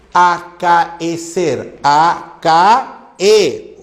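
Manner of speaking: "Acaecer" very slow, syllable by syllable; hiatus separation. Shown as a demonstration. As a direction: split "Acaecer" as a-ca-e-cer, a apart from e. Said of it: "Se separa".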